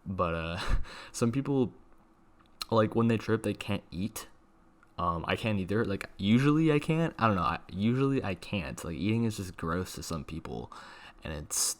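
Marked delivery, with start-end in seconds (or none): none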